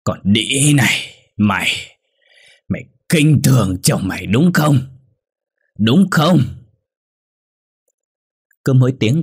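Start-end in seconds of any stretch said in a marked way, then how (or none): 0.0-6.4 put-on drunk husband bellowing, louder with each line; a savage shout that peaks on the last "Đúng không?"; furious yell. A narrator's reading. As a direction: roar at her in blind rage. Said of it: "mà gào lên".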